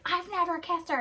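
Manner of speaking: high-pitched imitation of an upset man; hammed-up, indignant squeal of denial; upset, dramatic denial. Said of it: "he's getting so upset and, like, dramatic".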